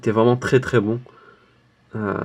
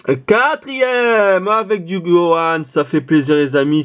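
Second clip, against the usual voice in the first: excited; 7 semitones higher, slower than usual. Real, not acted. Hyping fourth shiny card.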